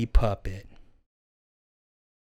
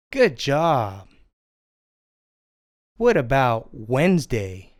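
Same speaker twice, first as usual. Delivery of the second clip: ordinary talk, nothing marked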